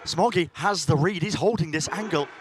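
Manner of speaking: rising excited play-by-play; esports caster. Real, not acted. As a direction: build the call as the fight unfolds. Live play-by-play call.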